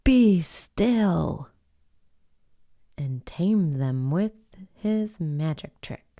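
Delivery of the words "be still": put-on loud commanding boy; stern command. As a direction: order the monsters to freeze. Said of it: "Till Max said".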